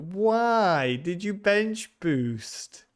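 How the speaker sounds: very slow, high, incredulous; mock disbelief. Real, not acted. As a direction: stretch it out in fake dismay. Teasing a viewer's bench boost.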